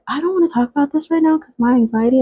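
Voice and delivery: mock her whiny brush-off, quickly; whiny excuse. Sister retold, avoiding conversation.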